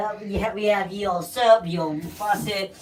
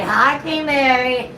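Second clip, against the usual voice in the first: cheery greeting; 5 semitones higher, 7 dB louder, slower than usual. Shown as a demonstration.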